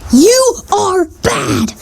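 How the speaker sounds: high, drawn-out hammed-up outrage; theatrical slow-motion telling-off; mock scolding yell. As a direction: scold it slowly in a strained high cry. Scolding the hated book.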